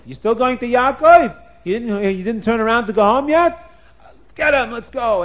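high, loud indignant shout; furious at his stubbornness; outraged attack order. Acted. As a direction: angels yelling and ordering the attack. Angels angry at Esav.

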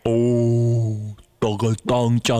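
low, dragged-out syllables; exaggeratedly slow. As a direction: overdo slowing down for the listener. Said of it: "Not that slow".

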